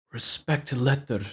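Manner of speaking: slow imitation of a Lebanese accent. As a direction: mimic a stern teacher's Lebanese accent. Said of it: "he has, like, an accent".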